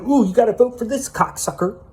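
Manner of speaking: high, whiny mock of a compliant person; mocking sheep voice. To his audience, mocking those who obey, high and whiny. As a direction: sneer it like a gullible follower. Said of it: "You're not gonna".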